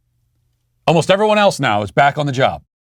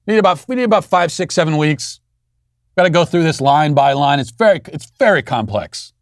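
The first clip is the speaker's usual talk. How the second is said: high, hurried mock-bureaucrat voice; mocking school board; 6 semitones higher than usual. Host mocks the school board stalling on the order, high mocking voice. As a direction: ham up a stalling official, pitched up. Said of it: "The Nashville School Board says".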